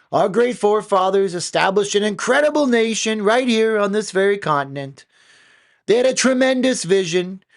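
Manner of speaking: high, loud Trump-style rally voice; loud, high mock Trump speech; Donald Trump impression. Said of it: "It did it just like Donald Trump".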